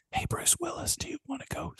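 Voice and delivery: whispered imitation of too-quiet film; mock inaudible whisper; barely audible movie dialogue. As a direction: whisper it like TV turned way down. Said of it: "you watch Die Hard with a Vengeance and it's like".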